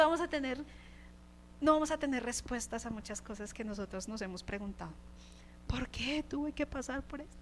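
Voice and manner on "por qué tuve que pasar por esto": breathy whisper of a hurting believer; whispered complaint. Acted. A believer whispering to God, a hushed lament. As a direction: whisper the question as if in private pain.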